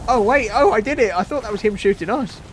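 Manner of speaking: high, loud burst of surprise; loud, high-pitched astonishment; surprised realisation. Real, not acted. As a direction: blurt it out on suddenly realising. Realises the shot was their own.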